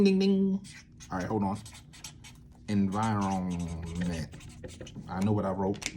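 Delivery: ordinary delivery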